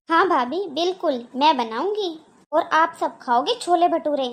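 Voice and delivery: normal talking voice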